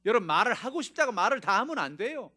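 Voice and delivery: high, loud pulpit call; preaching at full force. Acted. Preacher warning the congregation, loud and high. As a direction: call the whole room to attention.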